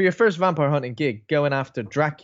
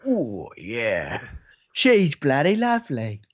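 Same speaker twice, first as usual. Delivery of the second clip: slow, lightly voiced lecherous count; leering Dracula; much slower than usual. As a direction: savour the words like a creepy admirer. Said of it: "I think what he does is this".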